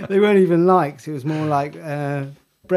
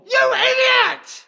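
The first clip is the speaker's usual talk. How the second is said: angry shout; slower than usual. Acted. Detective yells at suspect.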